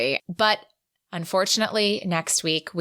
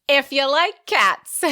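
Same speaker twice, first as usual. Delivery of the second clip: slow, higher, louder teasing lilt; playful; 7 semitones higher, 6 dB louder, slower than usual. Acted. Host pitching the next book to cat-loving listeners, playful. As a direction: sing it out to tempt cat lovers.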